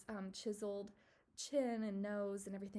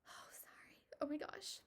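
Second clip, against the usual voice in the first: apologizing through a tearful whisper; swallowing back tears, words only breathed; choked-up whisper; 6 semitones higher, 5 dB quieter than usual. Real, not acted. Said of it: "I've been getting emotional on my videos".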